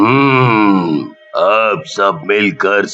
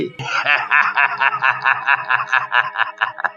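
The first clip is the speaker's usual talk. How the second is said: very deep, long villain cackle; evil ghost laugh; 5 semitones lower, 7 dB quieter than usual. Acted. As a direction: laugh low and menacingly. Ghost gloats over trapped family. (भूत की भारी, लंबी शैतानी हँसी)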